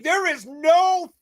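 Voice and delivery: stretched-out mock disbelief; incredulous disbelief. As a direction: drag it out in disbelief. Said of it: "And I'm just like".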